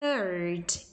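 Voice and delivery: "Third" starting on an airy TH, tongue between teeth; "Third" with a careful soft TH, not F; clear TH. Shown as a demonstration. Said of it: "Você tem que fazer os T H".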